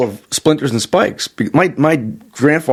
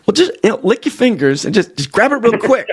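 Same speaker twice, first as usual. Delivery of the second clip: higher, offhand voice for dad's casual advice; imitating dad; 8 semitones higher than usual. Dad coaxing son to grab live wire, higher offhand voice. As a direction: toss it off like it's nothing. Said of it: "He goes".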